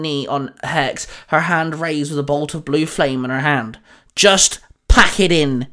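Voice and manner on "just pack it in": slow, loud barked order. A narrator's reading. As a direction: bark it as a forceful command. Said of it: "Daisy barked".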